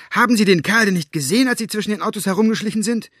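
loud, high and fast; shouting. A narrator's reading. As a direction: yell it out in a rush. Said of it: "schrie sie".

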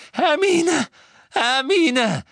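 high, loud frantic screams; desperate full-voiced yelling; screaming. A narrator's reading. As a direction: scream the name over and over. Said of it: "Hör auf zu schreien".

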